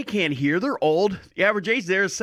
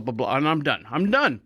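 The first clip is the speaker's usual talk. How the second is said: ordinary talk, nothing marked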